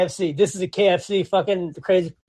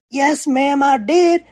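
insistent yes ma'am; 6 semitones higher, much slower than usual. Retold or imitated. Insisting to customer.

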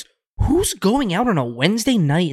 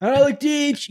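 high, called-out name like a megaphone announcement; pretend megaphone page; 11 semitones higher, slower than usual. Friend jokingly paging the missing brother, mock megaphone call. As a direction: page him over an imaginary loudspeaker. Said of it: "I wish I had a megaphone".

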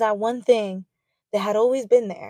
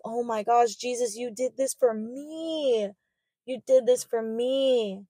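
tender awe; 5 dB quieter than usual. Retold or imitated. Tearful prayer to Jesus.

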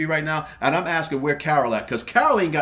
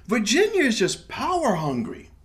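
normal talking voice

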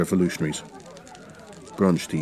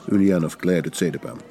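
usual speaking voice

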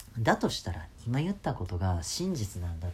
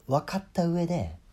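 ordinary talk, nothing marked

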